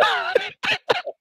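high burst of laughter. Real, not acted. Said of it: "Nossa, nossa".

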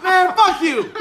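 high, loud retold yell; hammed-up outraged shout; angry comeback. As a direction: yell back at the dad, playing it up. Retold shouting back at dad.

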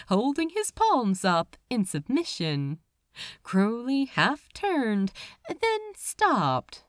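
ordinary delivery, nothing marked

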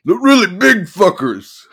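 sudden shouted outburst. Acted. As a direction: blurt it out loud. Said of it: "he shouted suddenly".

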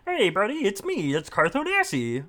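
high silly Kermit-like voice; goofy puppet character, loud and high; Carthonasi voice. Pretend. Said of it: "I'm not Kermit the Frog in a jumpsuit".

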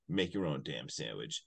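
low, soft, understated retort; deadpan punchline. Retold or imitated. Janine at the door with the shotgun, deadpan punchline. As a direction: drop it low like an action one-liner.

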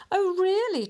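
high, loud mocking "oh, really?"; taunting. A narrator's reading. Wind mocks the sun's claim, taunting loud and high. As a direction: scoff at the sun's boast. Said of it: "taunted the wind".

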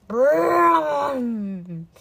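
loud, drawn-out rumbling howl; rolled "brrr" swelling into a howl; monster howl. A narrator's reading. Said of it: "Its howl shook the tunnel".

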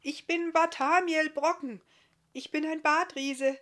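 high, loud, friendly introduction; friendly calling out. A narrator's reading. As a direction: call amiably to unseen strangers. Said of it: "rief Barthamiel freundlich".

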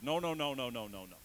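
loud repeated no's; refusing God's call, loudly; loud refusal. Acted. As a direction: push God away with a shout. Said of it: "I'm the one saying to God".

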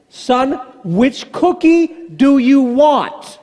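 slow, drawn-out mock threat to his son; mock menacing question. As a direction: drag every word out like a looming warning. Dad's threatening last chance to son.

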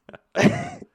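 very high, loud squeal of laughter; shrieking laugh. Real, not acted. Reacting to backwards challenge.

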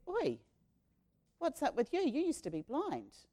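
high, jeering "Oi" from the synagogue bosses; challenging officials. Acted. Synagogue leaders challenging the healed man, high and jeering. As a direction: call him out like a suspicious official.

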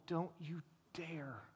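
whispered, slow and menacing. Acted. Preacher voicing God warning rebels, in a slow whisper. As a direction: breathe the warning in a hushed threat.

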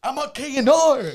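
high, loud boast; show-off telling strangers about the show, loud; bragging shout. Retold or imitated. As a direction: yell the station name like a proud drunk.

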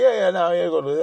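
mock eager agreement to cover cluelessness; faking it. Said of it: "I'm just gonna fake it".

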